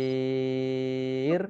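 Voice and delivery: "Basyir" with a long held "iii" before r; long stopped ending. Shown as a demonstration. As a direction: chant "Basyir" on one steady note. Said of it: "mat arif, lis sukun".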